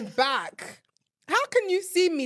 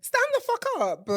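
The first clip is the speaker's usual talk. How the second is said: exasperated outburst; 10 semitones higher than usual. Real, not acted. Ranting about men on trains.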